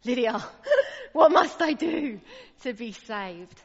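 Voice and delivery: loud, laughing cry of longing; joyful imagined plea. Pretend. A family member calling to Lydia across the lounge, loud and laughing. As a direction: call it out with a laugh of delight. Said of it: "I would love those words".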